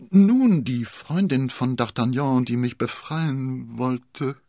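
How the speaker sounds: high, slowed, slurred speech of a poisoned woman; slurring. A narrator's reading. Constanze answering Athos, slurring. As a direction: let the words slur as she fades. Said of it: "lallte Constanze".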